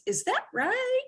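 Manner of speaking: "Is that right?" rising high at the end; questioning rise. Shown as a demonstration. Teacher showing the questioning meaning of the word. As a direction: ask "Is that right?" with a sharp upward lift. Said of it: "ask it as a question".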